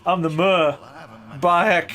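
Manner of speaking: put-on Cockney accent. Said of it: "I love this guy's accent".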